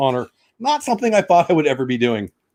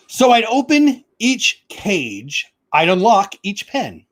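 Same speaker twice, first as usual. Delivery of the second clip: sing-song Seuss reading, drawn out in places; storybook reading; 6 semitones higher, much slower than usual. Acted. Reading Dr. Seuss aloud to viewers, bouncy storybook voice. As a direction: read it like a picture book for kids.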